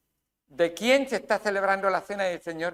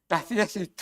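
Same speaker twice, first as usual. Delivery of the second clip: ordinary talk, nothing marked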